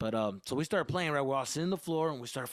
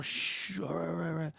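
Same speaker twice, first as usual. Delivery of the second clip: hushing; 4 semitones higher than usual. Retold or imitated. Boys hushing each other.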